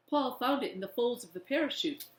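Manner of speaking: usual speaking voice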